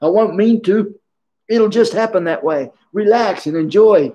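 high, playful voice of a gentle menacing master; giggly old master. Retold or imitated. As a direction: tease a student you are choking. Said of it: "He'd go".

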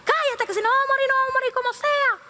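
very high-pitched, forceful scolding; acted angry yelling. Man ordering the crying one to shut up, angry yell.

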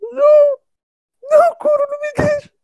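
shrieking, sobbing plea; terrified scream. Real, not acted. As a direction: scream in terror, crying. Left behind, screaming.

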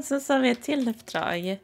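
ordinary talk, nothing marked

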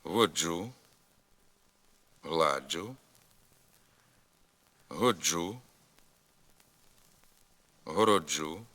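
each word set apart by long pauses; careful drill read with «дж» as one sound; slow model words for repeating. Shown as a demonstration. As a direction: say each word clearly for the class to echo. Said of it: "Повторюйте за мною".

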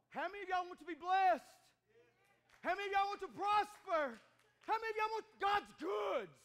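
loud rising preacher calls to the crowd; rally the congregation with each question; full-force preaching. Acted. Preacher asking the congregation who wants blessing, full-force preaching.

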